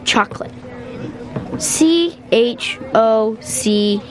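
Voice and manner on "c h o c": slow, halting letter-by-letter spelling; careful spelling. Acted. Boy spelling chocolate from memory for his teacher, slowly.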